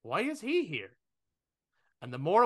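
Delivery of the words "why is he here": baffled question. Retold or imitated. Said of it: "And I went".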